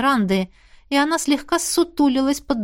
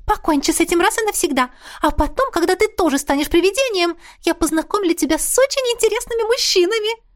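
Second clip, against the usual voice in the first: put-on fast, high, eager ghost girl; 9 semitones higher, faster than usual. A narrator's reading. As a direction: sell the idea brightly. Ghost Missy voiced high.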